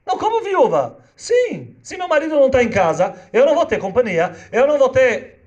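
hammed-up wife arguing with the judge; distraught wife. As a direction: squeal it like a wife who won't be left alone.

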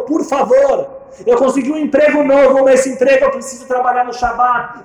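high, loud accusing wife; heated reproach. Retold or imitated. Wife reminds husband of his promise, high and heated. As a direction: hammer him with the past.

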